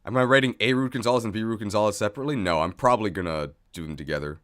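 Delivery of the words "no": ordinary delivery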